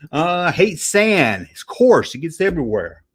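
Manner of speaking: usual speaking voice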